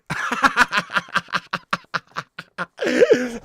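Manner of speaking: long, high laughing fit; hearty laugh. Real, not acted. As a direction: burst out in loud, real laughter. Laughs at the Kevin story.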